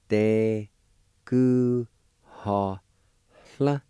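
"Te ku ha hla" broken into separate syllables with pauses; Cherokee syllables. Shown as a demonstration. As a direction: sound out "Te ku ha hla" piece by piece. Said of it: "And it's pronounced like this".

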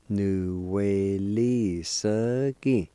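"Nu we li se gi" slowly stretched but linked; slow teaching demo of "Nu we li se gi"; drawn-out pronunciation. Shown as a demonstration. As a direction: draw out each syllable of "Nu we li se gi" without stopping. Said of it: "It's pronounced like this".

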